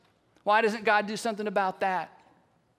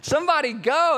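raised, louder prod with a smile; amused urging; 5 semitones higher, 6 dB louder than usual. Acted. Jesus smiling at frozen onlookers, loud amused urging. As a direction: egg the crowd on, high and loud. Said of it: "big smile on his face and he's going".

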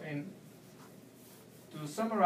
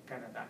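softer, lower "Canada" ending on DA; stress on last syllable; 4 semitones lower, 7 dB quieter, faster than usual. Shown as a demonstration. Teacher pronouncing it with the tilde, final-syllable stress. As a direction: quietly place the weight on the last "da" of "Canada". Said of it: "with the intonation of in da".

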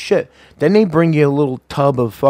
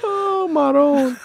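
mock exclamation; much slower than usual. Real, not acted. Exaggerated exclamation, slow high.

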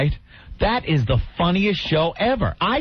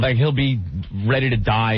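normal talking voice

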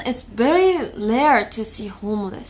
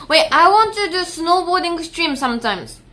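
usual speaking voice

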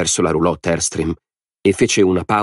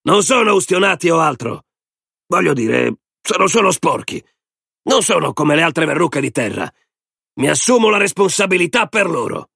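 high-pitched, insistent public appeal; Lori addressing the crowd; 10 semitones higher than usual. Acted. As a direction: vouch for strangers, calling out high.

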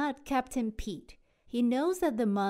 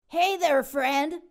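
louder, higher call; 5 semitones higher, 6 dB louder than usual. Acted. Captain yells to the sea monster, loud call. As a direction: call it across the water. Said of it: "he yells to the sea monster".

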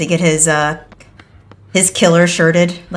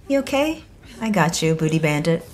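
much softer, gentle check-in; soft reassurance; 4 semitones lower, 8 dB quieter than usual. Real, not acted. Streamer gently reassuring teammate Booty Bandit, soft and calm. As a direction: comfort her quietly.

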